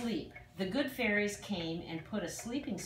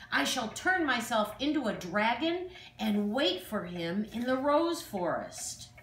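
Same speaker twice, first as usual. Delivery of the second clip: louder, scheming villain voice, a touch of fury; wicked fairy in a rage; 4 semitones higher, 4 dB louder than usual. Acted. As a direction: threaten like a wicked fairy plotting. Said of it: "she flew into a rage".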